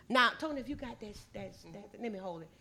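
normal talking voice

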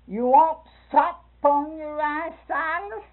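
loud, high, slow country call of sop; Sister Hall's call. Retold or imitated. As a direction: call it out across the table, drawn out. Sister Hall's version, louder and higher.

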